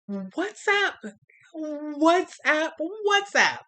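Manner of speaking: "WhatsApp" yelled high and loud each time; exasperated. Real, not acted. High, loud disbelief that he broke up over WhatsApp. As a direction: shriek it in disbelief.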